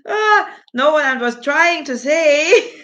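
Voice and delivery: high, loud, laughing through the words; laughing protest. Real, not acted. Laughing as chat mishears her words.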